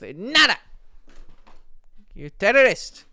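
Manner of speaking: loud high mock outrage; yelling at cat. Real, not acted. Streamer yelling at his cat, loud and high. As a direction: bellow at the naughty cat.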